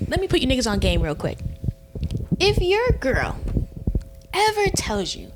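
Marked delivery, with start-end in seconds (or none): 2.4-5.3 high emphatic. Real, not acted. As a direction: preach it at them. Emphatic warning to friends.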